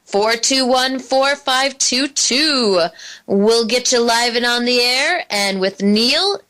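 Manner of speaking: normal talking voice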